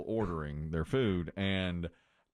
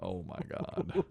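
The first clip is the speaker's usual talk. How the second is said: mock horror; 7 semitones higher, slower than usual. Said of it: "And I was like".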